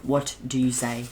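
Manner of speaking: normal talking voice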